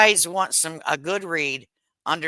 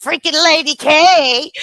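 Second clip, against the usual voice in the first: mock fan shout; 10 semitones higher, 8 dB louder, slower than usual. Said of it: "I have people saying".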